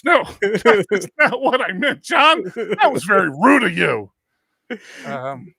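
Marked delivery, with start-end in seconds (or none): 0.0-4.1 playfully scolding, louder; mock offended. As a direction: fake indignation at a rude joke. Mock scolding John for joke.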